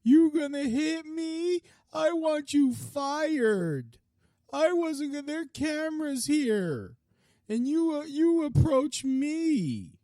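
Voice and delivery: high, slow whining mimicry of a scared bully; squeaky, drawn-out mocking whine; mock whiny coward. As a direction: make him sound like a sniveling coward. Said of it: "He's like".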